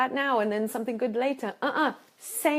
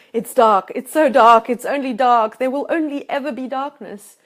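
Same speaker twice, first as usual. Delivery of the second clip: much louder, wailing gloom; mock despair; 2 semitones higher, 11 dB louder than usual. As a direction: ham up the drowning-in-darkness wail. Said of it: "swimming in the dark side going".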